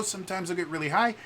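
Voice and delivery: quick line lifted high, rising at the end; high pitch. Shown as a demonstration. Streamer showing viewers his expressive range, high pitch. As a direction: jump up into a high register. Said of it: "my pitch travels a lot".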